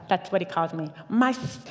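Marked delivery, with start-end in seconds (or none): 1.1-1.6 imitated Ugandan accent, breaking into a laugh; amused attempt at a Ugandan accent. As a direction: start a higher Ugandan accent and crack up. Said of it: "Ugandan accents are awesome".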